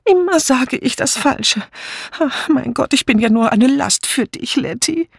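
high, fretful self-pity; unhappy wail. A narrator's reading. Dora Banner to Letty, high fretful lament. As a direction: lament your clumsiness. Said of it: "blickte unglücklich drein und rief".

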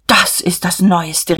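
slow, drawn-out exclamation; exclaiming. A narrator's reading. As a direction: call it out as startling news. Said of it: "riefen Emmy und Magda". (gedehnter Ausruf)

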